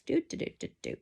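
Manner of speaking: soft whispered machine noise; breathy do-do-do walking sound; imitating a walking foot. Shown as a demonstration. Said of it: "walk on your fabric like this".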